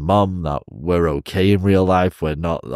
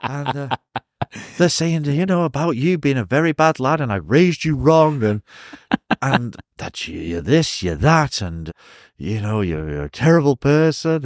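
mother voice; 6 semitones higher than usual. Retold or imitated. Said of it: "She goes".

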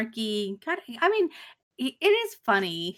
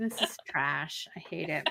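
disdainful judging; 5 semitones lower, 7 dB quieter than usual. Retold or imitated. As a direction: sneer at the photos, unimpressed. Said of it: "I could definitely see Krista judging".

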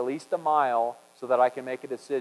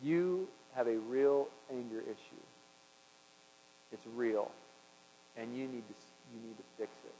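wife calmly tells him the hard truth; calm quiet rebuke; 3 semitones lower, 9 dB quieter than usual. Retold or imitated. Wife calmly telling husband his anger is real, soft and quiet. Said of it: "she calmly just said".

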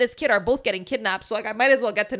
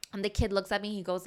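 ordinary talk, nothing marked

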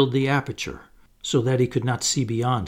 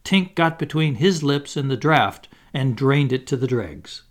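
usual speaking voice

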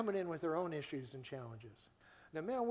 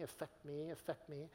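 "affect me" repeated much softer, like an echo dying away; fading echo; 2 semitones lower, 9 dB quieter than usual. Retold or imitated. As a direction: let "affect me" fade out like an echo. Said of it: "some of you are going".

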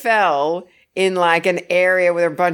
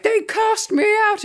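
high, wailing mock outrage; melodramatic outcast. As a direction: wail it like a wronged villain. Voicing angry Satan.

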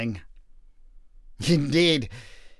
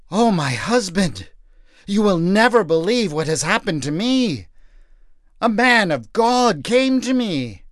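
breathless, high, eager telling; bursting with news; wife rushing in with news, excited; 5 semitones higher than usual. Acted.

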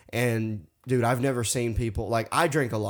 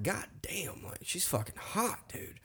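breathy near-whisper, much softer; hushed inner thought; 5 semitones higher, 12 dB quieter than usual. Retold or imitated. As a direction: mutter it under your breath like a private thought. Inner thought on seeing girl.

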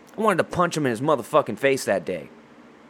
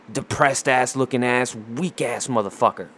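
ordinary delivery

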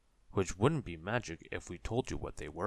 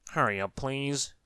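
urgent plea; 6 semitones higher, 5 dB louder than usual. A narrator's reading. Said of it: "who was rapidly turning into a snowman".

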